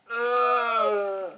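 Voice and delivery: loud high groan; long wounded "ugh"; pained moan. Acted. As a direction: groan out in pain, pitched well up. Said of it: "moaning a little bit".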